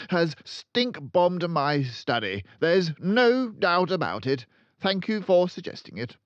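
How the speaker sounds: loud, high barking headmistress; booming tyrant headmistress; barking Trunchbull. A narrator's reading. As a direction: bark it out like a bully. Trunchbull rants.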